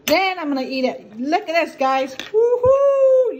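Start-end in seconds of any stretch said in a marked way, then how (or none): 2.2-3.4 high, loud cheer; excited whoop. Real, not acted. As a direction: whoop with delight. Excited about the bun.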